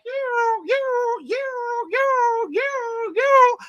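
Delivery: high, repeated you, you, you; mom pointing at everyone. Retold or imitated. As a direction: jab each you at someone. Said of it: "everybody in mom was like".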